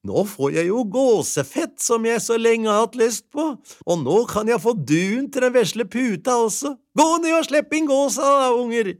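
put-on wife sending children for the sheep; gleeful wife. A narrator's reading. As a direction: build up to a happy call. Wife's happy voice.

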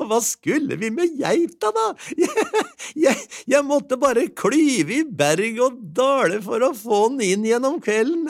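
high voice with giggles; wife mocking the goat chase; laughing wife. A narrator's reading. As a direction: laugh at the thought.

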